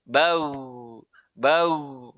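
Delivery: BAU as bagh, ending on a voiced throat gh; voice-only flow. Shown as a demonstration. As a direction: let only voice flow at the end of BAU, no breath. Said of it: "suara saja yang mengalir". (bau (bagh) hanya suara yang mengalir)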